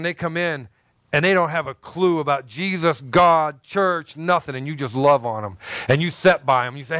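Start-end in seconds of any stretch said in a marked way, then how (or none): none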